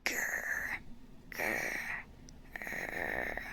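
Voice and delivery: mock puppy growl, dropping low; playful growl. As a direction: growl like a little dog, half in jest.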